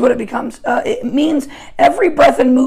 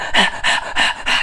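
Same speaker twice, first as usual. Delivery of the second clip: heavy, voiceless mock dog pants; imitated dog panting; 3 dB quieter than usual. Preacher imitates exhausted dogs in videos, panting. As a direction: pant like a worn-out dog. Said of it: "they're panting".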